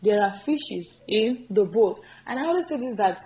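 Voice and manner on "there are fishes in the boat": slow, deliberate model line; slow teacher demo; slow correct form. Shown as a demonstration. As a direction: draw it out so they copy it. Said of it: "So it should be".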